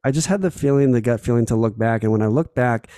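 ordinary delivery

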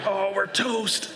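high, drawn-out groan of doom; mock despair. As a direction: wail slowly that all is lost. Dad's dread as plan fails.

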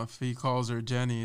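ordinary talk, nothing marked

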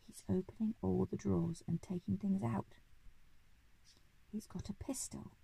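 urgent secret whisper. A narrator's reading. Giraffe warning the Duke of a burglar, whispering. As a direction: whisper it very softly, close to the ear. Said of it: "came up very close to the Duke and whispered".

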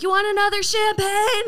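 sugar-coat it for the table; high bubbly server checking on guests; customer service voice. Retold or imitated. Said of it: "And I'm like".

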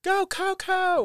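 high-pitched, drawn-out fan cheer; imitated cheering yell. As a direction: cheer as if from the stands, stretched out high. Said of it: "I was out there yelling".

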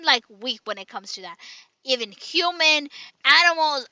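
ordinary talk

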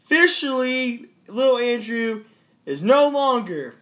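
loud, high mock announcement; news anchor voice.

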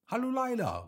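high, bright greeting called out; cheerful call. A narrator's reading. Hopsi calls out to the owl Laila, high cheerful greeting. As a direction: wave and call across to a friend. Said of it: "rief Hopsi und winkte ihr zu".

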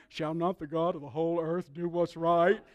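low mimicry with a laugh in it; amused mimicking. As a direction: chuckle while quoting his bold question. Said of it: "did you hear him".

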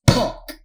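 loud, high "Tonk" like a body hitting ground; thud noise. Retold or imitated. Player mimicking bald man's thud on ground. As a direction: bark out the thud. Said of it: "he goes".

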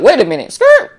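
"skirt" jumps way up high and loud like a braking car; record-scratch double take. Pretend. Friend asks for money, mock screeching halt. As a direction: slam the brakes in disbelief.

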